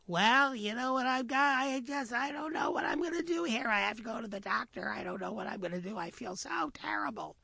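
high, whiny rabbit with a cold; high pleading rabbit voice; stuffy-nosed rabbit. Acted. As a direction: play a sly rabbit faking a cold. Rabbit claims sickness.

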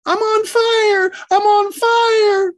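very high, loud, drawn-out wail; hammed-up burning-alive shriek; panicked scream. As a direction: scream it slowly at full pitch. Panicked boy screams he's burning.

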